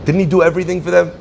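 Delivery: rattled off fast at normal pitch; quick, flat repeat. Acted. Preacher repeats his question quickly to the congregation, level and brisk. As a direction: toss it off fast, matter-of-fact.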